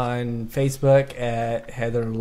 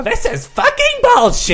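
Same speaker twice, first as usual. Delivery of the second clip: high-pitched, indignant mock yell; mock angry outburst; 3 dB louder than usual. Player imagining himself provoked by a flat-earth rant, mock angry yell. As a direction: blow up in hammed-up outrage.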